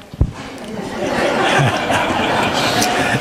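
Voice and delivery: long open laugh; amused laugh. Real, not acted. Moderator amused by audience member's comeback, long laugh. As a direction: burst into a sustained laugh.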